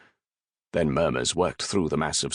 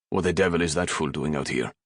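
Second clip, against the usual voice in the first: plain reading voice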